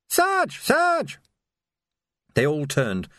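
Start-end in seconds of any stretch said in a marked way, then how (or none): 0.1-1.2 high-pitched, urgent call. Acted. Watchman hailing his sergeant in the street, urgent calling. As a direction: call out in a much higher voice.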